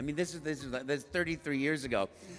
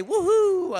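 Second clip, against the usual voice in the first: joyful woohoo; 12 dB louder than usual. Shown as a demonstration. Telling fans to cheer.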